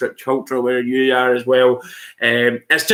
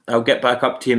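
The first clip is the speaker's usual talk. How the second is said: normal talking voice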